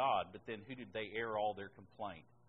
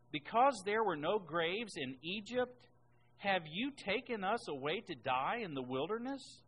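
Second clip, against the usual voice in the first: complaining Israelites; 6 semitones higher, 4 dB louder than usual. Acted. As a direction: grumble it up at Moses like a wronged crowd.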